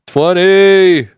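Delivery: high, loud cheer for the count; excited cheer. Real, not acted. Host cheering the viewer count, loud excited shout. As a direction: celebrate the milestone out loud.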